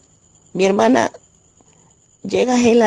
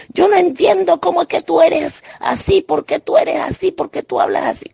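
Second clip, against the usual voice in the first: sister's higher-pitched nagging complaint; imitated reproach; 9 semitones higher than usual. Sister reproaching the speaker for how she talks, imitated in a higher voice. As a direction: mimic her complaining at you, pitched up. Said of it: "Así me decía".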